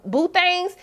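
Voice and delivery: usual speaking voice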